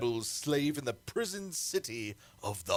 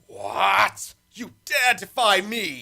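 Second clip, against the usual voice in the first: loud, higher indignant shout; outraged shout; 7 semitones higher, 8 dB louder, slower than usual. Acted. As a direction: roar back at defiance. Tyrant defied by priestess.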